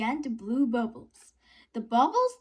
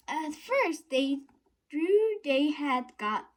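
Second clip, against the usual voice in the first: ordinary delivery, nothing marked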